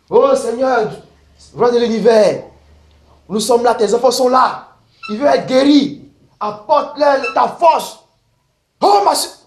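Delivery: loud charismatic prayer; fervent prayer. Retold or imitated. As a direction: cry out to God for the sick. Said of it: "ils font ça".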